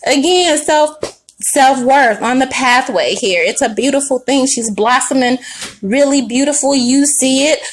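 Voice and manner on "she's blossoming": usual speaking voice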